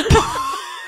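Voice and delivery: high rising shriek of laughter. Real, not acted. Reaction to bagel line.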